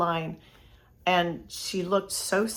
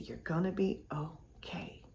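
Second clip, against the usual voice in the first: much softer and slow, hushed; tender reassurance; 2 semitones lower, 12 dB quieter than usual. Retold or imitated. Pulling the stranger back from a hug, soft slow reassurance. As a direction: say it gently, nearly breathless, to calm her.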